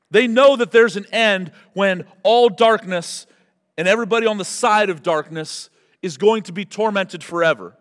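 loud and high with fervour; loud fervent sermon voice; forceful preaching. Acted. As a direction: drive the point home hard. Voicing demons and preaching.

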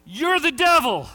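high, loud accusing yell; shout. Retold or imitated. Homeless man yelling at the young preacher, a high loud yell. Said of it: "and he shouts".

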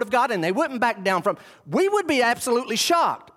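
high-pitched, emphatic sermon delivery; impassioned preaching. Real, not acted. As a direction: preach it with mounting disbelief. Preaching with rising force.

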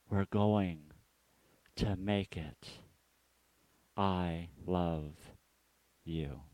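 soft, slow, fading to almost nothing; God murmuring reassurance to his child; tender hush that trails away into silence; gentle whisper-like comfort. Retold or imitated. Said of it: "God continues to whisper".

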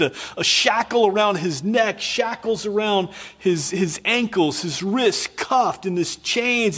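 high, urgent preacher's build toward the scene; impassioned preaching. Acted. Preacher to congregation, picturing Joseph in chains: high, urgent preaching.

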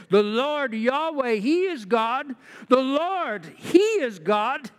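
high, slow, emphatic proclamation; crowd's acclamation. Acted. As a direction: proclaim it as an awed congregation, drawn out. Crowd acclaims God, read aloud.

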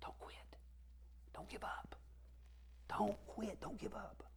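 soft, breath-only urging with pauses; whisper. Acted. Preacher voicing God whispering to the discouraged, whispered. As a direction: whisper it close, as gentle encouragement. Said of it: "He'll whisper things like".

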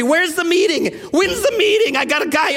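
high, urgent rapid questions; frantic calls. Retold or imitated. Pastor phoning for an AA meeting, frantic and high. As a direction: pitch up, desperate to find a meeting. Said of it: "I'm cursing every".

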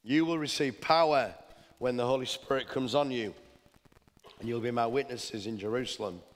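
scripture proclamation. Acted. Pastor proclaims scripture to congregation.

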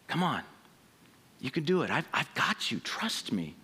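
soft, low and gentle; tenderly coaxing someone to let go; gentle invitation. Retold or imitated. Jesus calling us off the diving board, soft and tender.

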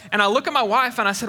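usual speaking voice

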